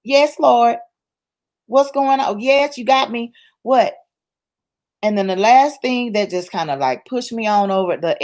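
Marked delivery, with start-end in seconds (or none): 0.0-3.9 asking God. Acted. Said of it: "you better stop and ask God".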